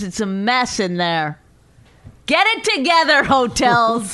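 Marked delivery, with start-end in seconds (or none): 2.2-4.1 loud, exasperated outburst; exasperated shout. Real, not acted. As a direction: yell at the hotels. Rant at hotels.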